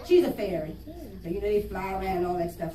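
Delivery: usual speaking voice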